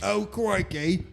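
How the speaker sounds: high-pitched, hammed-up British accent; mock British exclamation. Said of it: "That's my British accent".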